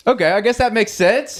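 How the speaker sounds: high, lightly put-on voice accepting it; mock agreeable. To a coworker, retelling his reply, high mock acceptance. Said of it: "And I'm like".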